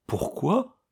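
high puzzled echo of the word Pourquoi; baffled, high questioning Pourquoi; bewildered echo. Acted. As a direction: repeat Pourquoi, thrown off. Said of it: "déroutée".

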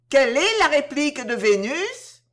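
plus fort et plus aigu, question criée en chœur; à tue-tête. A narrator's reading. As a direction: crier « Quelle est la réplique de Vénus? » à pleine voix. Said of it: "plusieurs voix répétèrent à tue-tête". (question lancée à tue-tête par plusieurs voix)

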